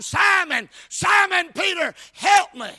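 high, loud mock plea; preacher imitating a desperate call; crying out. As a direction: holler for help by name. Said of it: "He is not crying".

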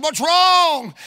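alarmed retold question. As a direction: re-enact an urgent demand to know. Retells alarmed question to wife.

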